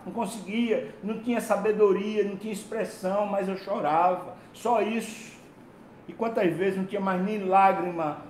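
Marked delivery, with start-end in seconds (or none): none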